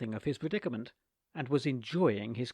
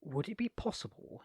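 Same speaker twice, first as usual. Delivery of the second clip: ordinary delivery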